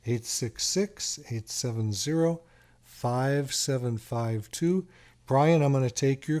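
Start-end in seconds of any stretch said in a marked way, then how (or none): 0.0-4.9 reads the number out slowly, digit by digit; slow, clear phone-number read; slow dictation. Shown as a demonstration. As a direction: spell out the number slowly for callers to write down. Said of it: "give us a call at the studio".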